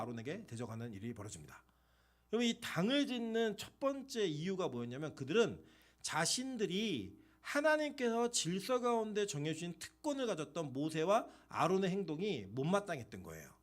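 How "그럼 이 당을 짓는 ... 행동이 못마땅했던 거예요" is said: ordinary talk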